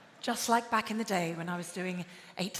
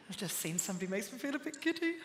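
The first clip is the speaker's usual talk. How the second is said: giddy aside; 3 semitones lower, 8 dB quieter, much faster than usual. Pretend. Flustered aside on spotting Andrew Scott.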